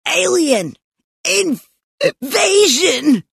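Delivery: high cry broken by a hiccup; excited kid, word caught on a hiccup. A narrator's reading. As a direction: hiccup midway through the word. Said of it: "Stink hiccuped".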